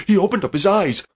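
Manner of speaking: usual speaking voice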